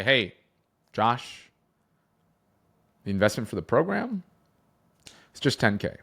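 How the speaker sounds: long silence after "Josh" and after "program"; deliberate pauses. Shown as a demonstration. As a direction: let each phrase hang before dropping the price. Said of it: "intentionally pause to allow a greater emotional impact".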